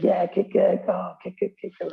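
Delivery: high, excited cheering at the TV; hammed-up husband yelling at the match; cheering football fan. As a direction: egg the players on like a couch fan.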